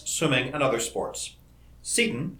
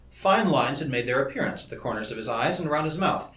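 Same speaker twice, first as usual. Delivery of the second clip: usual speaking voice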